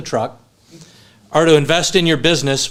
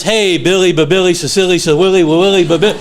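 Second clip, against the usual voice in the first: louder, bouncy singsong babble; Robert's energetic nonsense greeting; 3 semitones higher, 5 dB louder than usual. Retold or imitated. Friend answering the phone, imitated goofy high energy. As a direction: rattle off rhyming nicknames, imitating an excitable friend. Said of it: "the energy when you would call Robert".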